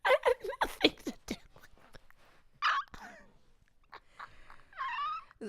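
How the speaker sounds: high helpless laughter; laughing fit. Real, not acted. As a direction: lose it completely laughing.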